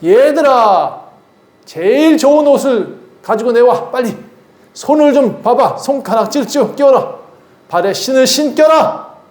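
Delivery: a father who can't contain his joy, barking quick orders; father shouting for the servants in delight; overjoyed father calling servants. Acted. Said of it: "아버지가 뭐라 했냐면 종들을 불러요".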